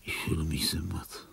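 faint, worn-out whisper; exhausted whisper. Acted. Dying man whispering his exhaustion. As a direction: sigh it out with no strength left.

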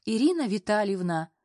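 ordinary delivery, nothing marked

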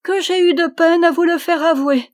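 high, loud, laughing delivery; the princess laughing at the knight's confession. A narrator's reading. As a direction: laugh through the words, delighted. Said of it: "La princesse se mit à rire".

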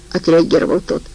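plain reading voice

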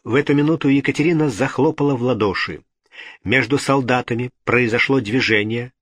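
ordinary delivery, nothing marked

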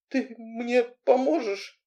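high, slow, hopeful appeal. A narrator's reading. Heroine asking Anton for help, hopeful. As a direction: ask slowly, hanging on the answer. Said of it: "спросил я с надеждой".

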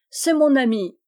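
loud, high emphatic call; crying out. A narrator's reading. Yann vouching for his friend to Rose Eyre, crying out. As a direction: shout it as a proud assurance. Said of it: "cria Yann".

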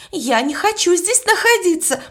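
first refusal, still held back; scared refusal. A narrator's reading. Young maid refuses to stay, first take, mildly scared. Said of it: "плакала и дрожала".

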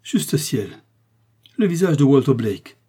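s'écrier, saisie, plus fort; cri d'effroi. A narrator's reading. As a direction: lancer le nom comme devant un fantôme. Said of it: "elle poussa un cri aigu". (elle reconnaît le visage du mort, cri d'effroi)

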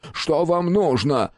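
high, drawn-out desperate cry; hysterical cry. A narrator's reading. Overpowered Гельман demands what the attacker wants, hysterical. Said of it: "в истерике выкрикнул он".

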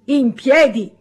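loud clipped order from the teacher's desk; stern command. A narrator's reading. Teacher ordering the new boy to stand, loud command. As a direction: snap him to his feet.

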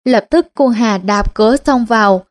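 usual speaking voice

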